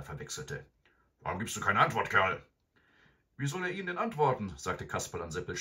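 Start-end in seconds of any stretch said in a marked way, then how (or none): 1.2-2.4 louder robber demand; gruff demand. A narrator's reading. Hotzenplotz voiced by reader. 3.4-4.5 put-on high, fast boyish voice; cheeky Kasperl. A narrator's reading.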